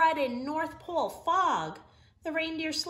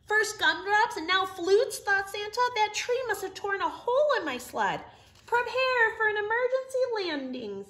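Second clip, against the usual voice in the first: higher, louder worried Santa; urgent Santa; 11 semitones higher, 7 dB louder than usual. Acted. Santa warns the reindeer of the landing, higher and louder. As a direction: call out the landing order urgently. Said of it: "Santa instructed".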